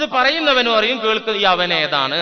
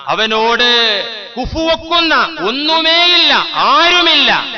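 emphatic preaching; 5 semitones higher, 6 dB louder than usual. Real, not acted. Preacher building emphatic climax.

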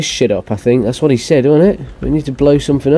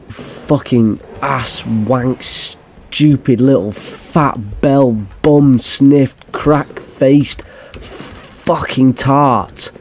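slow insult string; much slower than usual. Real, not acted. Gamer cursing the game, slow drawn-out insults. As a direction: drag each insult out slowly.

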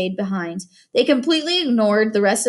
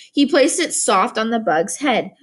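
ordinary delivery

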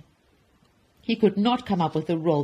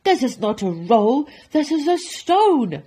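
loud, higher, indignant bellow building to the end; angry roar; 7 semitones higher, 9 dB louder than usual. Acted. Duke rejecting the baker's roll, angry roar. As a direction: roar it, leaning on the last word. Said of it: "roared the Duke".

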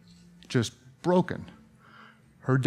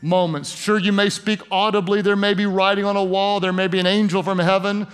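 heartfelt preacher's prayer with lifted hands; pastoral prayer; 4 semitones higher than usual. Acted. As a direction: lead the congregation in earnest prayer. Pastor leads congregation in prayer.

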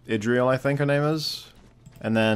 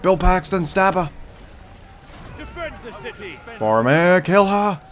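raised, high-pitched commands; urgent called orders; 8 semitones higher than usual. Real, not acted. Player sends heroes at the elf witch, urgent shouted orders. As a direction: call out to your heroes urgently.

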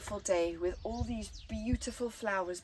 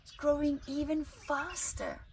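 excited; 9 semitones higher than usual. Acted. Excited contrast in story.

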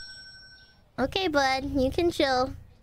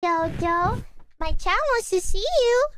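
pet talk; 6 semitones higher than usual. Real, not acted. Cooing to cat for viewers.

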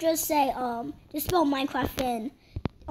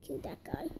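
whisper; 2 semitones lower, 16 dB quieter than usual. Real, not acted. Sneaky game command.

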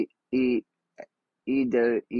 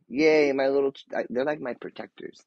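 normal talking voice

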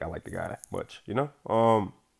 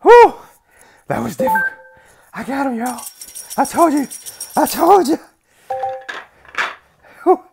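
high, loud whooping celebration; elated gloating; 12 dB louder than usual. Real, not acted. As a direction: crow to everyone about the win. Celebrating a hard-won victory.

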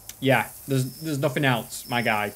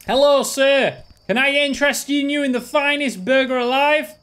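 much higher and louder hawker's call; burger vendor voice; 7 semitones higher, 4 dB louder than usual. Acted. Vendor calling out to passing customers, high loud sales pitch. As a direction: sell burgers like a carnival barker. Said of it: "I don't know why I'm doing the voice".

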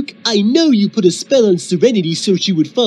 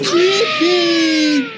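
distorted cry; 7 semitones higher, slower than usual. Acted.

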